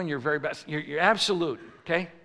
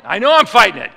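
loud, higher, exasperated comeback; exasperated retort; 4 semitones higher, 12 dB louder than usual. Retold or imitated. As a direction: snap back at the doctor in frustration. Acted retort to the doctor.

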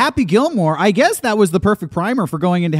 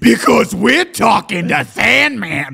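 slow, emphatic showman delivery; hyped up; 4 semitones higher, 6 dB louder, slower than usual. Acted. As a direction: drag it out like a hype man. Hyped host rebuttal.

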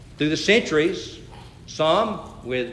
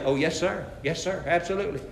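soft, lower, deferential replies; meek compliance; 3 semitones lower, 9 dB quieter, faster than usual. Acted. Condemned prisoner answers the man in authority, meekly. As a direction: agree humbly and quietly.

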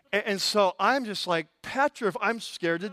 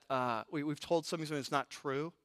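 ordinary delivery

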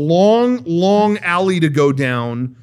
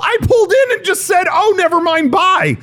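a driver protesting a parking fee, pitched-up disbelief; incredulous protest; 12 semitones higher than usual. Retold or imitated.

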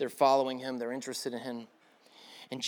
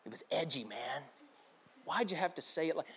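very soft, low mock grumble; low, subdued put-on complaint; imitated hurt complaint; 4 semitones lower, 11 dB quieter than usual. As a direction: mimic the volunteer's hushed reproach. Imitates the volunteer's complaint again.

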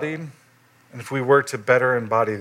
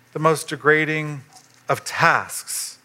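ordinary delivery

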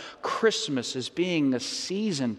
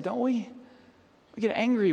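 ordinary talk

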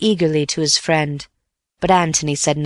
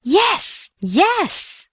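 much higher, urgent insistence; insisting. A narrator's reading. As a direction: cut in, insisting eagerly. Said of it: "insisted Bill".

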